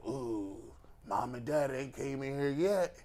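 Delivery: whispering under the covers; hushed, hopeful kid in bed; hushed child voice. Retold or imitated.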